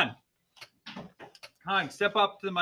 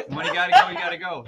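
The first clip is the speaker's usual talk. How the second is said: laughing; 9 semitones higher, 4 dB louder, faster than usual. Real, not acted. Host laughs at bathroom question.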